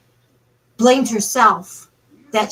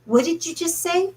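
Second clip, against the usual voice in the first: high, hushed, taken aback; higher, softer astonished ask; startled question; 7 semitones higher, 5 dB quieter than usual. Retold or imitated. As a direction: she can't believe what she heard. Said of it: "she turns around and she goes".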